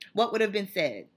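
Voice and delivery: ordinary talk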